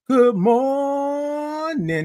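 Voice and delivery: very slow, high held MORNING; high, sustained sing-out greeting; drawn-out good morning. Shown as a demonstration. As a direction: stretch MOR way out on a high note. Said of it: "That's what I want you to do".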